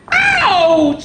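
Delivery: high, loud little squeak; a tiny hedgehog squeaking in pain; squeaked ouch. Acted. Hedgehog hit by an apple, high squeaky ouch. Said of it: "squeaked Hedgehog".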